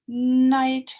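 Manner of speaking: loud night. Shown as a demonstration. Teacher repeating night loudly. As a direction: call out night.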